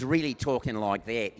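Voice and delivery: loud, broad Australian vowels; pronounced Australian accent. Retold or imitated. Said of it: "very pronounced accent".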